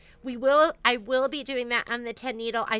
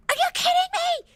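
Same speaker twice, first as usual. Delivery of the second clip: high, loud outraged mom yell; re-enacted yell; 6 dB louder than usual. Mom re-enacting yelling at her kid over the kitchen, outraged yell. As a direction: shriek it in mock fury. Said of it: "you should have heard me yell at Kai".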